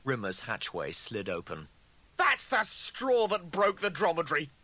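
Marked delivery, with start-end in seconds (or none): 2.2-4.5 high, loud outraged yell; furious scream. A narrator's reading. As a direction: scream it back at your rival. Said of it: "Rimmer screamed back at his double".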